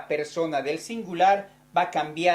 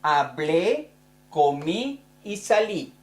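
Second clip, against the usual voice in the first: Hablé, comí, salí with the last vowel stressed; a teacher drilling the accent on the last syllable; stressed final syllable. Shown as a demonstration. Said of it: "en la primera persona se pone acento".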